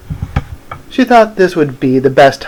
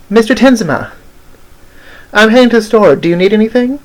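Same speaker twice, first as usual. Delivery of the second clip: high-pitched insistent call; mild yell; 9 semitones higher, faster than usual. Acted. Odette to Mister Tinsma after waiting, yelling lightly. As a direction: call out higher, voice only a little raised. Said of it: "Odette yells again".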